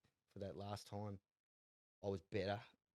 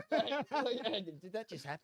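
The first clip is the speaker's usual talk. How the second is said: laughing disbelief; 12 semitones higher, 5 dB louder than usual. Retold or imitated. Retelling moment snake bit him.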